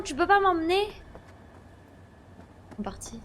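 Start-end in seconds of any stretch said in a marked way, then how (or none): 2.7-3.2 breathless whispered dismay; whispering. Real, not acted. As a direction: whisper it to yourself. Car gone, repeats to self.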